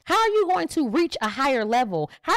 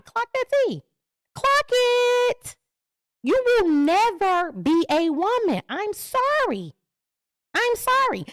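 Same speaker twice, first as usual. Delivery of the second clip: sassy callout; 9 semitones higher, slower than usual. Acted. Sassy high-voiced callout.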